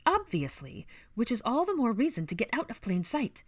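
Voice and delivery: put-on high, fussy old woman's telling-off; exasperated high-pitched lecture; scolding. A narrator's reading. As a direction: scold them for being careless. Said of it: "she scolded".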